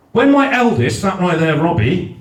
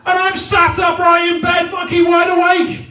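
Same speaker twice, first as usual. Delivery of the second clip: high-pitched comic tirade; exasperated rant; 10 semitones higher than usual. Retold or imitated. Husband raging about his sleeping wife, exasperated. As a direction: rant in indignant disbelief, voice pushed up.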